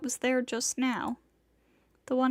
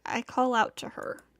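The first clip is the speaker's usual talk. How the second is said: ordinary delivery, nothing marked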